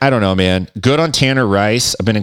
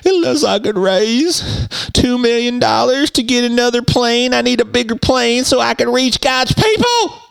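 much higher, put-on preacher voice pleading for a plane; a mock televangelist begging the flock for jet money; televangelist impression. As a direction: ham it up as a greedy TV preacher. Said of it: "I like what the televangelists do".

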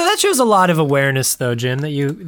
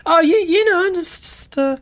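nosy neighbor voice; 7 semitones higher than usual. Pretend. Neighbor admiring car, acted voice.

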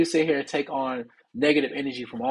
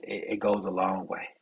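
ordinary delivery

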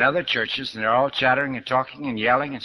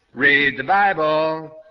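slow, drawn-out loud yell, higher; street preacher yell; 4 semitones higher, 4 dB louder, much slower than usual. Retold or imitated. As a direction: bellow it like a street preacher down the block. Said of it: "and he yells".

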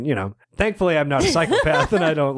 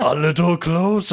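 amused, hammed-up villain command; movie villain imitation; 4 semitones higher than usual. Quoting a favorite movie part, hammed-up villain imitation. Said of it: "that part where he's like".

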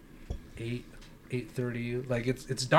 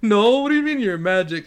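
put-on doubting kids; 7 semitones higher, 6 dB louder than usual. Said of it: "And we're like".

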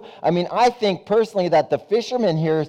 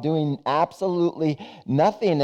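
normal talking voice

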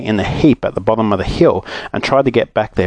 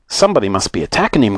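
ordinary delivery

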